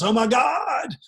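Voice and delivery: high, loud cry of Oh my God; panicked yell to God. Acted. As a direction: shout it out in panic as the bear strikes.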